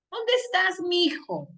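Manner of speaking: mock call for a child, hijo run together as mijo. Shown as a demonstration. Teacher mimicking a mother calling her son. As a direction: sing out where are you, mijo. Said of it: "Por ejemplo".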